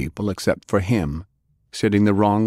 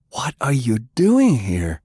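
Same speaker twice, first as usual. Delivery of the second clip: high, slow, amazed question; stunned to see him; drawn-out incredulous ask; disbelief; 6 semitones higher, 3 dB louder than usual. Acted. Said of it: "he asked in disbelief".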